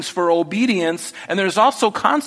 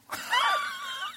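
burst of laughter; 11 dB quieter than usual. Real, not acted. Laugh after donkey line.

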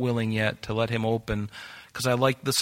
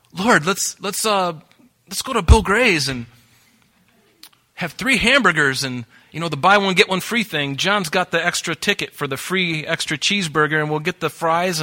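eager Peter; 4 semitones higher than usual. Retold or imitated. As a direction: blurt it out like an overexcited disciple. Peter voiced, eager babbling.

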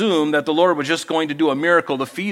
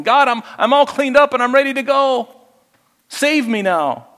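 higher eager voice, proud of being cleaned up; self-satisfied believer; 9 semitones higher, 3 dB louder than usual. Retold or imitated. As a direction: play a smug do-gooder presenting himself. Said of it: "coming to Christ going".

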